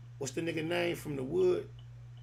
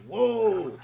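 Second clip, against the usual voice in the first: a mock amazed whoa, a bit louder; 4 semitones higher, 7 dB louder than usual. Host reacting to her big singing voice, mock amazed whoa. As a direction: imitate the host's stunned reaction. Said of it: "he was, like, so amazed".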